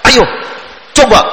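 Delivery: a curt, hard "Ayo, coba"; short and harsh, like an impatient teacher; harsh command. Shown as a demonstration. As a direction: snap the order at the child with no warmth. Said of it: "kan kelihatan keras, kasar".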